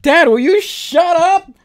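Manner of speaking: loud, high, slow yell at dad to shut up; really fed up, shouting him down; annoyed shout. Real, not acted. Vlogger yelling at her dad to stop interrupting, annoyed loud shout.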